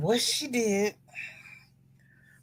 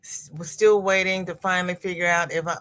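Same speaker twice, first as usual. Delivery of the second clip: normal talking voice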